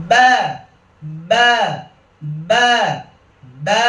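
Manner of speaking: ringing drilled "ব্যা", open a; released upward. Shown as a demonstration. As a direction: lift and release the vowel of "ব্যা". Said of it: "আওয়াজটা উপরের দিকে ছেড়ে দেওয়া".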